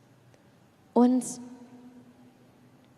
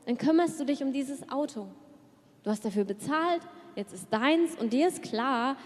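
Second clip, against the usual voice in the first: usual speaking voice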